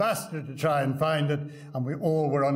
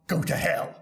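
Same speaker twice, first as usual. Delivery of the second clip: dry retort. Retold or imitated. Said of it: "Parsons took out the monocle from his eye, looked at Churchill and said".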